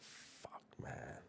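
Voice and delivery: breathy whispered dismay under the breath; hushed, unvoiced groan of dismay; whispered curse. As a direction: whisper it like you don't want him to hear. Dismayed aside about new cellmate.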